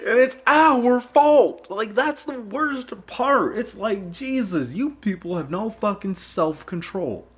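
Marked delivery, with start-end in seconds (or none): 0.0-6.1 loud high-pitched exasperated rant, slow. Real, not acted. Ranting at viewers with no self-control, loud and exasperated. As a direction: rant at them, fed up and loud.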